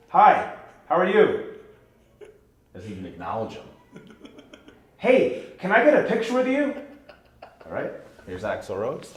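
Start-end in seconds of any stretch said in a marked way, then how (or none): 0.1-1.5 slow, high, loud eager hello; loud greeting. Retold or imitated. Overeager fan greeting the rock star loudly. 5.0-6.7 high, loud eager plea; loud request. Retold or imitated. Fan loudly asking the rock star for a picture.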